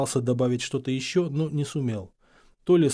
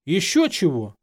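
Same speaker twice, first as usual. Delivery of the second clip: raised, indignant retort; bristling; 4 semitones higher, 4 dB louder than usual. A narrator's reading. Harry told to wait outside, flaring up. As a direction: flare up in refusal. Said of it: "взвился Гарри, ощетинившись".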